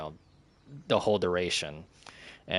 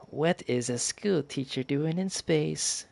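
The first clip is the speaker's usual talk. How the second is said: higher, slow put-on voice; trying a silly voice; 7 semitones higher, slower than usual. Grace testing voices for Rocky, higher slow put-on voice. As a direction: draw it out in an odd made-up voice. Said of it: "trying to go through various voices".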